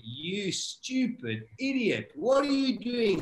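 high, drawn-out rebuke to himself; exasperated self-scolding. Retold or imitated. Swimmer berating himself while drowning, exasperated retold scolding. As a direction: stretch each word in mock exasperation.

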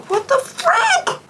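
very high, slow squeak of shock; shocked squeal. Real, not acted. Fan reading her ticket email, squealing in shock. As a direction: stretch it out in stunned disbelief.

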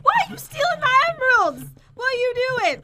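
high, loudish outraged wail; indignant protest. Real, not acted. Player to rival stealing the emeralds, indignant protest.

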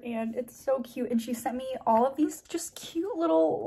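normal talking voice

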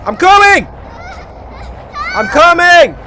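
high, loud holler; urgent calling. Pretend. Player rushing to the little girl, urgent holler. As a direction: shout so the distant child hears you.

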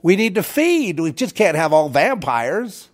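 hammed-up begging vampires; mock vampire townsfolk. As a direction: whine it like needy vampires begging to feed. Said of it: "they'll say".

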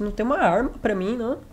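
normal talking voice

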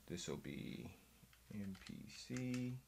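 very soft, absent-minded murmur; muttering to himself. Real, not acted. Naming the new template to himself, muttered very softly. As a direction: trail off under your breath, talking to yourself.

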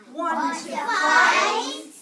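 loud, high, picking a fight; grouchy challenge. A narrator's reading. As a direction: bark it out like a tiny bully. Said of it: "said the grouchy ladybug".

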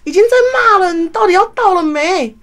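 imitating the boss yelling down the phone; boss scolding on phone. Boss on the phone demanding where the courier is, loud and scolding. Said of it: "然后问说".

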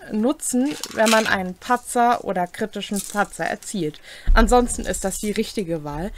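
normal talking voice

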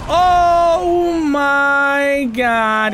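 very slow, high cry of alarm; drawn-out dismay. Real, not acted. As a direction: stretch it out as disaster lands. Boss lands on player.

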